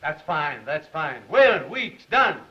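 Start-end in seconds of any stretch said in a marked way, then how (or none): 1.3-2.4 higher, loud summons; calling out. Acted. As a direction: bark their names out to call them in. Chief summoning staff.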